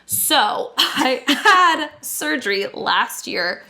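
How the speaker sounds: laughing through the words; breaking into laughter mid-line; giggling as the story starts. Real, not acted.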